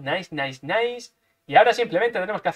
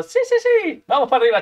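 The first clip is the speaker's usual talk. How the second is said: high burst of joy; gleeful yes; excited; 7 semitones higher, 5 dB louder than usual. Real, not acted. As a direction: squeal it happily. Excited at progress.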